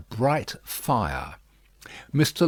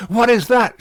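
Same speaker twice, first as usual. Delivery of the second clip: much higher, louder sudden alarm; startled cry; 13 semitones higher, 10 dB louder than usual. A narrator's reading. Lucy startled by a noise at the fire, frightened cry. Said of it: "she cried all at once".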